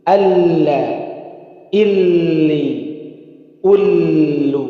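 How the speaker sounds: loud, ringing recitation for the class; lam drill. Shown as a demonstration. Teacher reciting lam syllables loudly for students. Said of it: "Perhatikan lagi, fokus".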